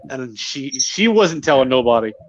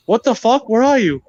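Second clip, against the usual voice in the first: higher, mock-exasperated demand; exasperated imitation; 7 semitones higher, 3 dB louder than usual. Retelling wife searching for hidden husband, exasperated. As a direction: imitate her demanding an answer, pitched up.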